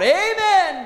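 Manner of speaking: high, loud shouted Amen; crowd's ringing Amen; joyful shout. Acted. All the people shout.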